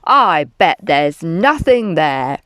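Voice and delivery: low, loud dismissive dad; low, scornful bluster; scoffing. A narrator's reading. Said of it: "and scoffed".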